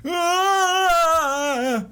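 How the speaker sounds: high, loud, drawn-out acted scream; yell of pain. Captive touched on the shoulder, long high yell of pain. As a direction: cry out as the wound is touched. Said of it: "he yells out in pain".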